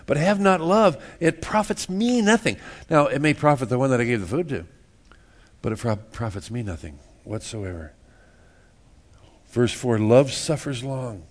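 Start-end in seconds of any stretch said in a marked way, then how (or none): 1.2-2.6 pitched high, pressing the point; emphatic. Shown as a demonstration. Preacher to congregation, insisting, pitched high. First take, raised high. 5.6-7.9 dropped low and soft; quiet repeat. Shown as a demonstration. Preacher repeating the point to congregation, low and soft.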